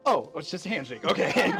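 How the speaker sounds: startled laugh. Acted. Ada startled by huge arm.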